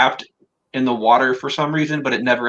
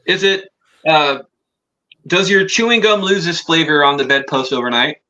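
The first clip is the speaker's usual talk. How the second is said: ordinary talk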